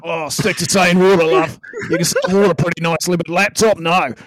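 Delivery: loud put-on Aussie critique; hammed-up Australian accent. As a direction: tease her in broad Australian. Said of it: "needs her Australian accent".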